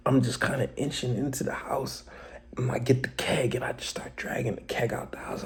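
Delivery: unvoiced whisper, sneaking; sneaky whisper. Real, not acted. Sneaking into the house to steal the keg, whispered. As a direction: hush it like sneaking past fights.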